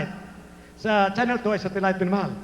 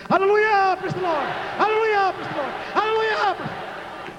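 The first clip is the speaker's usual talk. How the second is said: high, ringing praise chant; loud hallelujah shout; 9 semitones higher than usual. Real, not acted. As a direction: lead the crowd in shouted praise. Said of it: "sumigaw ka ng malakas na hallelujah".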